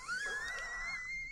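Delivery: sudden high squealing laugh. Real, not acted. Amused by a new drunk slang term, squealing laugh.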